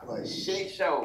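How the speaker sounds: ordinary talk, nothing marked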